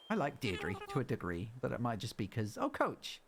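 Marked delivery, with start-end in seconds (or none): 2.6-3.2 bright, high and eager assistant; high cheerful helper voice. Pretend. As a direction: read it like a chirpy island assistant. Reads helper dialogue in voice.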